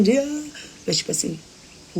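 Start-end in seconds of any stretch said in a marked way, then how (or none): 0.0-0.4 high, loud fake "ah" wail; mock crying wail. Shown as a demonstration. Wife showing how her husband doesn't cry, mock wailing. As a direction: demonstrate exaggerated bawling. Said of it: "Ele não chora assim".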